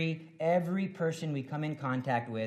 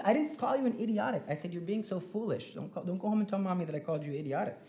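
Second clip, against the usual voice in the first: defensive backpedal; 4 semitones higher, faster than usual. Retold or imitated. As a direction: hurriedly take it back. Teacher backpedals to kid.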